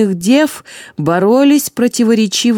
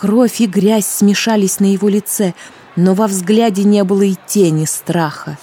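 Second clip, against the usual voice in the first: usual speaking voice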